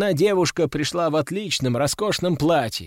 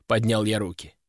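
usual speaking voice

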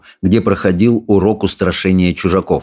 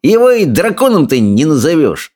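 sneering, sing-song bully, pitched way up; jeering bully; 9 semitones higher than usual. A narrator's reading. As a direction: taunt the others with relish. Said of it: "не унимался Сопляк".

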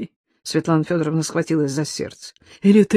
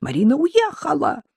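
terrified protest; 12 semitones higher than usual. A narrator's reading.

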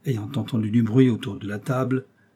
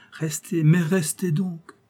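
higher, drawn-out plea to stay; raised pitch, stretching the words; insistent urging; 7 semitones higher, slower than usual. Acted. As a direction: press your friend, lightly coaxing. Guest insists host stay.

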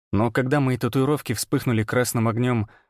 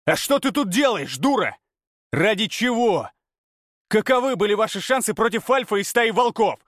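angry berating; 10 semitones higher than usual. A narrator's reading. Hero berating girl.

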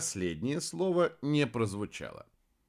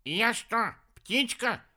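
high, indignant retort; 12 semitones higher, slower than usual. A narrator's reading. Ostrich objects to being caged like a bird, indignant. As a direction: ask it high, affronted. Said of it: "возмутился страус".

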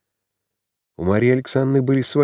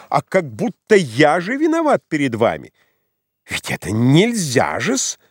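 exasperated; 7 semitones higher than usual. A narrator's reading. Perplexed suitor's indignant complaint.

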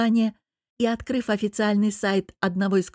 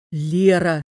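louder, aghast call of the name; shocked gasp; 2 semitones lower, 6 dB louder than usual. A narrator's reading. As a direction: exclaim it, aghast at the accusation. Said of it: "ахнул муж". (ахнув, потрясённо)

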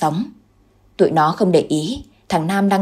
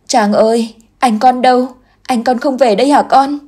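grieving mother asks; 6 semitones higher, 4 dB louder than usual. Acted. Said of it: "khóc như điên như dại".

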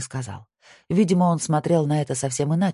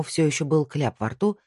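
ordinary delivery

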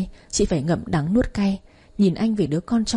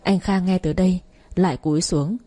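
usual speaking voice

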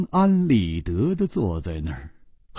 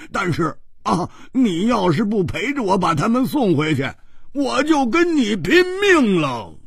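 wild yelling; 8 semitones higher than usual. Acted. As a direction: screech in exasperation, ending high. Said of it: "胡铁花怪叫着说". (怪声怪气地大叫)

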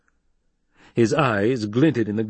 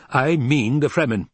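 higher, emphatic retort; firm insistence; 6 semitones higher, 4 dB louder than usual. Acted. Hawat confirms he means Fremen, insistent. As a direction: throw it straight back, certain.

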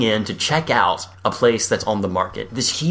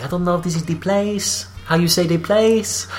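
put-on hesitant French accent; 6 semitones higher than usual. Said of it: "she's hesitant".

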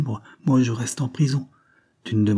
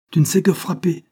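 cutting taunt, higher; cruel; 8 semitones higher, 4 dB louder than usual. Acted. Woman taunting the man behind bars, cruel. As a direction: wound him with the words. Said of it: "dit-elle cruellement".

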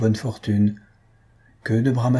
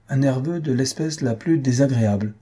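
usual speaking voice

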